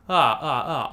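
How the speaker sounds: firm, present ah with no extra air. Shown as a demonstration. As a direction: keep the voice forward and firm on ah. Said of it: "on voice".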